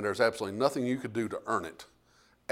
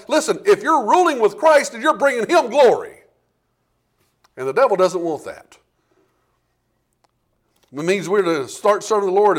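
louder, higher, driving sermon voice; preaching at full force; 6 semitones higher, 8 dB louder than usual. Acted. Preacher rousing his congregation, full-force preaching. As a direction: hammer the point home to the congregation.

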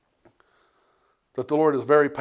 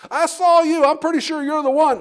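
much higher and louder, sure of it; insistent accuser; 13 semitones higher, 6 dB louder than usual. Acted. Bystander insisting to Peter that he saw him, much higher and louder. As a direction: press him, certain you saw him.

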